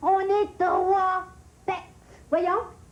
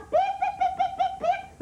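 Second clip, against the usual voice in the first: much higher, chirpy mocking of bird peeps; bird chirp mimicry; 12 semitones higher than usual. Colère mocks the birds with chirpy peeps. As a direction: squeak it out like an annoying little bird.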